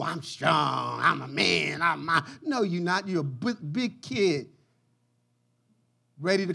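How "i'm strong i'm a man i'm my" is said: mock tough guy. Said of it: "Pretending that you cool".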